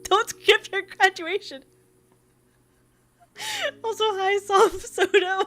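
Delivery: high, insistent disbelief; incredulous. Real, not acted. Streamer scolds a fan for skipping graduation, incredulous. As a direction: protest in high-pitched, laughing disbelief.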